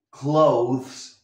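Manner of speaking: "clothes" with a voiced th. Shown as a demonstration. Teacher stresses the th sound in "clothes". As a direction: bite the th in "clothes". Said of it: "La T H importante".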